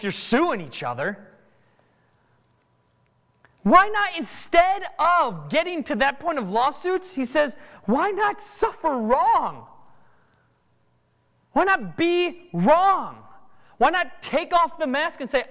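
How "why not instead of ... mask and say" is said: excited. Acted. Preacher pleading climax.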